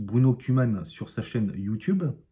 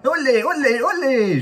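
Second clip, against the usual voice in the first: much higher, louder olé cheer; cartoon cheer; 13 semitones higher, 5 dB louder, slower than usual. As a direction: cheer like a Mexican cartoon mouse. Cheering Speedy Gonzalez. (ovation à Speedy, olé joyeux beaucoup plus aigu)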